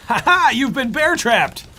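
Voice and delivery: loud, high gloating cackle into a drawn-out taunt; mock villain taunt. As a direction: crow over your trapped victim, hammed-up. Acts out springing a bear trap.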